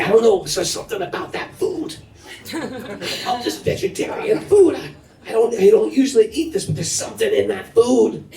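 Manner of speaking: a hint of a baffled friend; puzzled friend. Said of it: "And he'd say".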